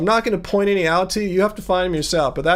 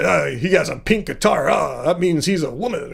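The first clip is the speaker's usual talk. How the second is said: mocking some guy; 2 semitones lower than usual. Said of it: "But some guy".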